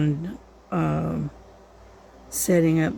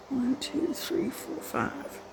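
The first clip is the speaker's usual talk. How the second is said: whispered counting; 7 semitones higher, 8 dB quieter, slower than usual. Real, not acted. Counting stitches under her breath.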